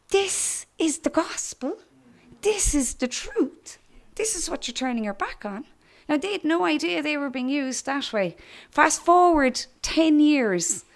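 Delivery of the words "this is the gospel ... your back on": voice of the Lord. Retold or imitated. The Lord speaking solemnly to the preacher. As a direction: let each phrase land gravely. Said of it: "what the Lord said to me".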